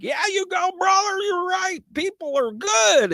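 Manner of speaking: very high, slow falsetto cheer; excited cheering. Retold or imitated. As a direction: squeak it out like a thrilled fan. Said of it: "and I'm like".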